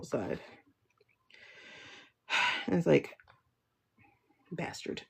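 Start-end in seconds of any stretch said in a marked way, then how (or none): 4.5-5.0 breathless whispered curse; unvoiced bitter whisper; whispered insult. Real, not acted. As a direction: spit it out under your breath. Bitter aside about her father.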